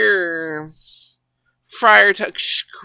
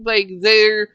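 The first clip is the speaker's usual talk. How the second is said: ordinary talk, nothing marked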